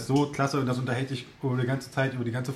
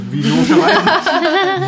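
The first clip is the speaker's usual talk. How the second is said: laughing; 11 dB louder, much slower than usual. Real, not acted. Laughing while answering.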